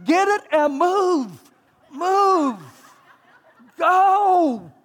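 pushing people to hurry up; impatient barking orders. Retold or imitated. Pastor impatient at traffic.

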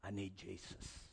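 very hushed, low broken-man plea; retold weeping plea. Heckler drops to his knees before evangelist, hushed tearful plea. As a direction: barely voice it, imitating a weeping man. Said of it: "began to weep and say".

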